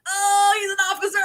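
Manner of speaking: hammed-up high-pitched "uh-oh" reaction; mock alarmed realization. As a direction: shout it in comic dismay. Retells realizing the man outranks them.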